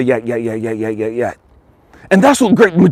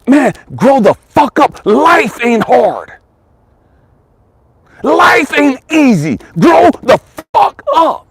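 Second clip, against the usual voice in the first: shouted rebuke; 7 semitones higher, slower than usual. Real, not acted. Preacher yelling at whiny listeners to grow up. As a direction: bark it at them, drawn out.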